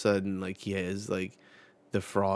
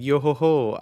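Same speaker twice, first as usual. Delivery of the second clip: louder, higher singsong laugh; weird laugh; 6 semitones higher, 11 dB louder than usual. Retold or imitated. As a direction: belt the yo ho ho out like a pirate. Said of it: "you got a weird laugh".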